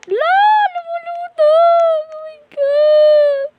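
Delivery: very high, loud, slow scream; shocked scream. Real, not acted. Gamer seeing the character float, screaming in shock. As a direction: scream in disbelief.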